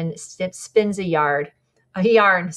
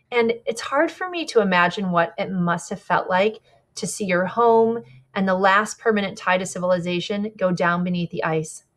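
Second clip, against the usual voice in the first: ordinary delivery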